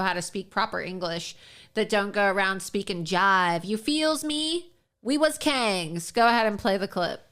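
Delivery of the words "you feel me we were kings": slow, drawn-out mocking slang drawl; lightly hammed mock street talk. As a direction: parody a politician's put-on slang. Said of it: "You think that we talk like this".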